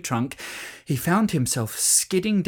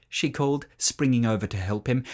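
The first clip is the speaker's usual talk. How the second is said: usual speaking voice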